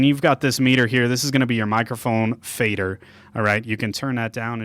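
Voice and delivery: normal talking voice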